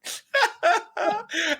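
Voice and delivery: high burst of laughter; loud laugh. Real, not acted. Hearing about Starfield's trailer.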